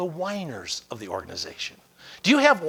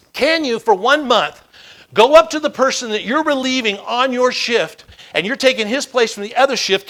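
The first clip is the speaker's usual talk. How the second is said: loud, higher, punched-out request; emphatic challenge; 6 semitones higher, 8 dB louder than usual. Retold or imitated. Mentor sets firefighter a second task, emphatic. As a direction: push each step home with force.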